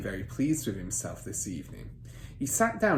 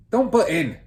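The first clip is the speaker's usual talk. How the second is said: loud higher rebuke; irritated snap; 8 semitones higher, 10 dB louder than usual. Acted. Father snapping at Matilda to keep out, loud. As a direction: cut her off sharply.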